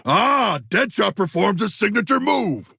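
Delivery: hammed-up delighted cheer; excited shout. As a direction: cheer the teammate's big move. Admiring ally's move mid-battle.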